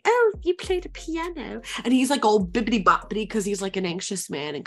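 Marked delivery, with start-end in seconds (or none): 0.1-1.6 high, affected voice for a fiancée; high-pitched mock sweetheart; Victoria. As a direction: pipe it up high, lightly. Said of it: "Victoria comes up and, like".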